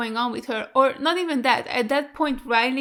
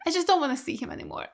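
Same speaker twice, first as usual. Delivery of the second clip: ordinary delivery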